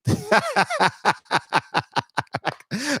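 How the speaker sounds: high rolling laughter; long rising laugh. Real, not acted. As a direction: crack up laughing. Laughing at own story.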